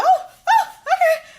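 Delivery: very high, loud giddy squeal with laughter. Real, not acted. Retelling her giddy reply to the cute guy, squealing with laughter. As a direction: shriek with delight.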